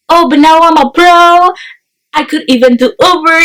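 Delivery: a hint of a smug friend showing off; teasing friend. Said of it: "Lexi was like".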